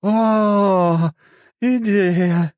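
high, tipsy cry of sudden idea; drunk exclamation. Acted. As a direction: blurt it out, drunk and delighted. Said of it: "воскликнула пьяная богиня".

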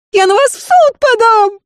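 high whining wail; whimpering threat; tearful voice. A narrator's reading. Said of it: "послышался плачущий голос". (плачущим голосом)

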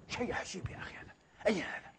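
hushed, breathy marvelling aside; awed whisper. Real, not acted. Preacher marvelling at Umar's humility, whispered. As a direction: drop to a whisper in wonder. Aside in awe after story.

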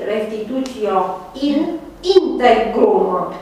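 restitutio in integrum said slowly, every syllable clear; slow Latin legal phrase. Shown as a demonstration. Law teacher quoting a Latin maxim to students, slow and careful. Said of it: "Principiul de drept pe care l-ați, uh, tot auzit".

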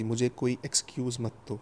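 ordinary delivery, nothing marked

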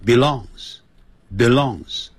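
belongs said slowly, each syllable clear; slow model belongs. Shown as a demonstration. Teacher modelling how he pronounces belongs for students. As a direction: sound out belongs twice, slowly. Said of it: "我们的发音会给你念成".

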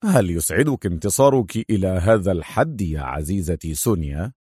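low-pitched, understated mockery; lightly mocking question, voice dropped; sarcastic. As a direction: tease her with dry scorn. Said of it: "وهو يقول في سخرية".

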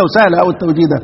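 usual speaking voice

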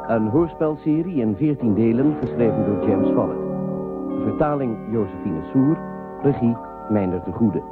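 ordinary talk, nothing marked

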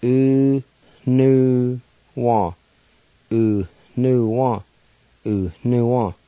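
U, nu, wa spoken slowly with a nasal N; careful Cherokee syllables. Shown as a demonstration. Teacher demonstrating the nasal N in U nu wa. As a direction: sound out U nu wa, stressing the U. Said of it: "like this".

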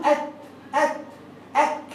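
"أت، أت، أك" with short a and a crisp stop; vowelless consonant drill. Shown as a demonstration. Teacher coaching a small child, "أت، أت، أك" ending crisply. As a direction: stop dead on each of "أت، أت، أك". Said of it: "ممكن تقول له كده".

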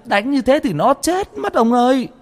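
desperate wailing plea. A narrator's reading. Mother begging husband to stop beating son, wailing plea. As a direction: beg him to stop, voice rising in panic. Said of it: "bà vợ gào lên".

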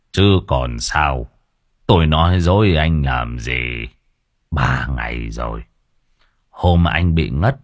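put-on weary old man brushing off the young thug; gruff old man. A narrator's reading. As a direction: wave him off like a tired old villager. Old man voiced in story.

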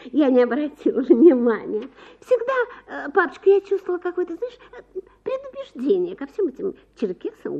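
put-on a flighty young wife gossiping to her husband; young wife chattering. A narrator's reading. Wife's lively gossip voice.

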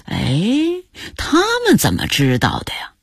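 puzzled rising "eh?" then wondering question; wondering to oneself, mildly bewildered; baffled. A narrator's reading. Grandpa wonders how strangers found out, baffled. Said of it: "朱旭还莫名其妙呢".

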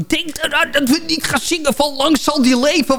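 imitating a flustered reminder; mild retold panic. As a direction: urgently warn everyone, half in panic. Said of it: "iedereen was een beetje in de paniek".

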